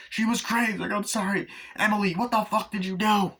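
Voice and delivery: high, squawked blame and excuse; acted frantic quarrel. Emily being blamed for the shooting, acted frantic quarrel. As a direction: imitate a panicked family row.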